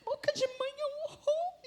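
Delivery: high-pitched imitated crying; hammed-up sobbing complaint; mock tearful wail. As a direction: wail it like a weeping teenage daughter. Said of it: "foi pra dentro do quarto chorando".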